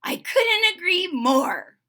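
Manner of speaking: high, loud, drawn-out delight; happy bark. A narrator's reading. Fuji agreeing with Freddie about the party, happily. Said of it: "Fuji barked happily".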